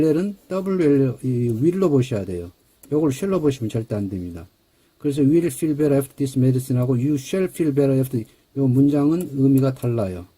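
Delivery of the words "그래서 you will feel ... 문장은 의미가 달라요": ordinary talk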